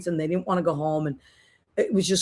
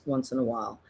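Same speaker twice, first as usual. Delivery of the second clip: ordinary talk, nothing marked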